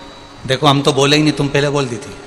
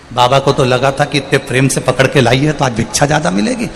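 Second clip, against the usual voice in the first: ordinary delivery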